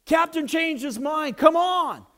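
high, loud sailor's call; yell. Retold or imitated. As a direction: yell after someone walking away. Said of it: "they yell to him".

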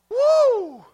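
loud, high "woo" holler; joyful whoop. Real, not acted. Whoops after declaring joy.

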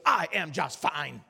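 slow, low, deliberate brush-off; suddenly curt reply. Retold or imitated. Passenger bristling at a Bible question, slow and low. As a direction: weigh each word, shutting the talk down. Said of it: "like a switch was flipped".

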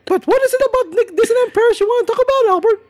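very high cartoon mouse falsetto; Nikki Moss. New theme park correspondent answering the host's call, cartoon mouse falsetto. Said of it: "this is Nikki Moss".

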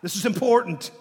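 loud, urgent call to attention; attention-getting. Acted. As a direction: make them listen up. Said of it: "addressed repetitively like that. It's like".

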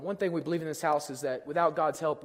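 normal talking voice

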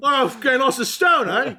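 high hammed British bluster; mock Bale. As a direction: boast about weight like a pompous star. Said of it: "Bale parody".

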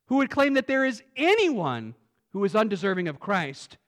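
ordinary talk, nothing marked

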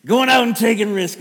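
loud, high exuberant cheer. Retold or imitated. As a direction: keep cheering the son on. Proud dad cheering son on.

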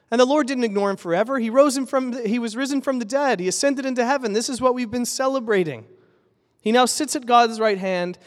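joyful proclamation; rising fervor. Real, not acted. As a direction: celebrate the resurrection, lift the voice. Preaching resurrection triumph.

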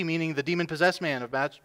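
normal talking voice

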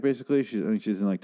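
ordinary talk, nothing marked

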